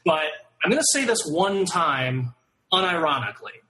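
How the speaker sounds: usual speaking voice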